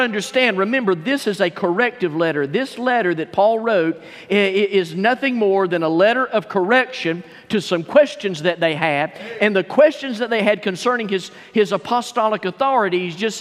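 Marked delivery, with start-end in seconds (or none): none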